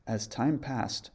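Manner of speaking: ordinary delivery, nothing marked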